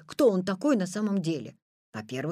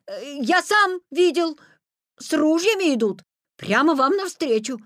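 loud, raised, urgent report; emphatic insistence; 7 semitones higher, 6 dB louder than usual. A narrator's reading. Petya bluffing the Wolf about armed hunters, urgent loud insistence. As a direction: press the bluff forcefully.

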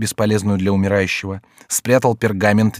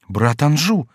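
higher-pitched, aghast exclamation; horrified; 5 semitones higher, slower than usual. A narrator's reading. Francois seeing his brother Anjou arrive, horrified. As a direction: recoil in horror, voice lifted. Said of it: "с ужасом воскликнул Франсуа".

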